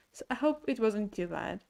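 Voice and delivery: ordinary talk, nothing marked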